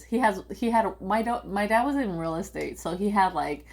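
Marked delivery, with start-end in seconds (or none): none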